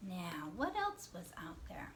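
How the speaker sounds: soft, half-whispered thinking aloud; hushed musing to young pupils; hushed wondering. Pretend. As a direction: ponder quietly as if searching your memory. Teacher wonders aloud to children.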